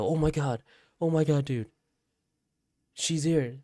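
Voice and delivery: high, urgent re-enacted shock; retold alarm. Waking from nightmare, telling brother, acted alarm.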